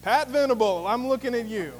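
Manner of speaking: storyteller's inspector naming the suspect aloud; dramatic accusation. Retold or imitated. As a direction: point the finger and call her out. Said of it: "the person that no one is suspecting".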